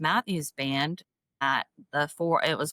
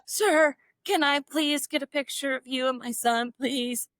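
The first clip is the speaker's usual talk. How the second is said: high, excited fan begging for a photo; gushing fan plea; 7 semitones higher than usual. Retold or imitated. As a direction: gush like a starstruck fan. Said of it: "that kinda hello".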